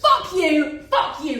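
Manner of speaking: angry yell. Acted. Furious retort in a fight.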